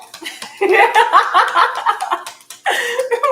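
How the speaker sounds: long, high, loud cackle; helpless real laughter in rolling bursts; cracking up. Real, not acted. Reader amused by her own dog impression, loud high cackling.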